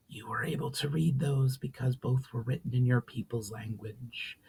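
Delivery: a spirit breathing the ancient greeting; ghostly whisper. Acted. Spirit greeting Valinar in old tongue, ghostly whisper.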